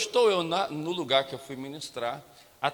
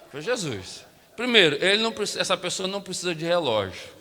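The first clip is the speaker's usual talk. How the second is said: usual speaking voice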